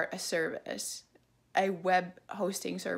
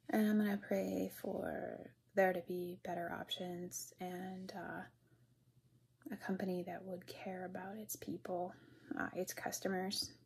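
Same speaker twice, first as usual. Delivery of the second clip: a whispered resolve to pray against the company; hushed vow; 7 dB quieter than usual. Real, not acted. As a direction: drop into a soft, intent whisper. Announces a prayer, then whispers.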